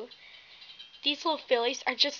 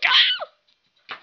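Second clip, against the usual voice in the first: high startled shriek cutting off the line; sudden scream; 5 dB louder than usual. Pretend. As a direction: break off into a shocked yelp. Bully interrupted, screams.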